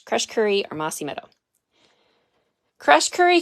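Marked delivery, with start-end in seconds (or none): 2.8-3.4 high, loud eager call of the name; much higher and louder excited echo of a viewer's pick. Retold or imitated. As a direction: shout out the choice with delight. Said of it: "Kathy says".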